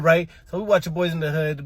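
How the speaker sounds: ordinary delivery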